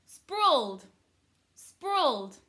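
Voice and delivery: loud, high swoop falling away; modelled full word. Shown as a demonstration. Teacher giving pupils the full word, loud falling call. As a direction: sing it out high, then let it drop.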